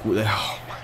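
usual speaking voice

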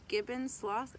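usual speaking voice

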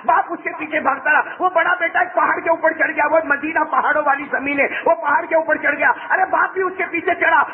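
high, breathless chase narration; full-force preaching. Acted. Preacher telling of father chasing son uphill, high and urgent. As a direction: chant the chase high and insistent.

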